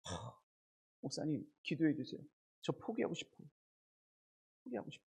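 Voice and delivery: very soft, sighing wish to give up; imitated worn-out student. Preacher imitates exam students asking pastor for prayer, drained. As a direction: sigh and confess quietly, as if all strength is gone. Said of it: "이런 학생들이 저에게 그래요".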